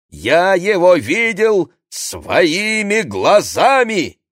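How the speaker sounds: thundering, slow and high; booming male voice. Acted. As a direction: boom it out so she flinches. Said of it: "отвечает таким громовым голосом".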